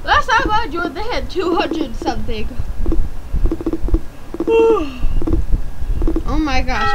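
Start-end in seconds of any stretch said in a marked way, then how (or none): 2.5-6.3 big audible yawn; long yawn. Real, not acted. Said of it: "I'm so sorry for yawning in camera".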